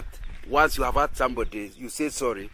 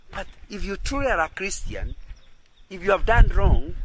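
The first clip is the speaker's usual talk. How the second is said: ordinary talk, nothing marked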